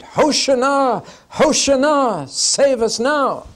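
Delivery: high, loud, slow cheering cry; crowd shouting. Retold or imitated. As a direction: shout it like a hopeful crowd at the gate. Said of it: "they shouted".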